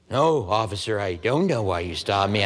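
meek innocent act for the cop; clueless driver. Retold or imitated. Driver plays clueless to the officer, low and meek. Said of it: "completely clueless".

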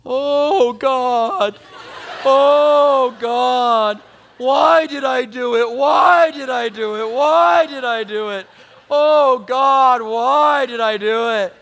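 hammed-up child wailing in regret. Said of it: "I hear him going".

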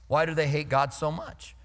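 ordinary talk

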